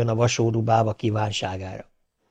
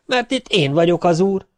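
defiant shout; 7 semitones higher, 3 dB louder than usual. Acted. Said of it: "kiáltotta a szegény ember".

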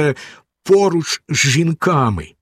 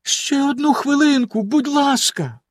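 pleading; 12 semitones higher than usual. A narrator's reading. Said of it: "попросила його дружина".

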